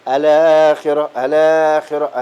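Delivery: الآخرة with the long vowel stretched slowly; slow Quranic recitation of الآخرة, madd held long; drawn-out madd. Shown as a demonstration. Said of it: "اتنين، أربعة، ستة".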